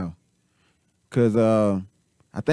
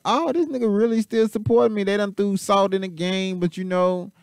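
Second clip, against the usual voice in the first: higher, mock-smug voice of the guy getting paid; imitating Polite; 7 semitones higher than usual. Reactor voices Polite being sent money, higher mock voice. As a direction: play Polite chuckling that he still gets money. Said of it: "He was like".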